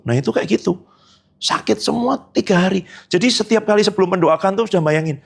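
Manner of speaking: usual speaking voice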